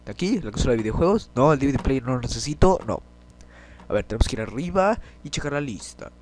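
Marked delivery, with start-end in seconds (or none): none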